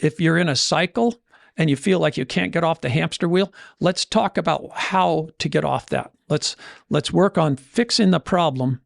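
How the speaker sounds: usual speaking voice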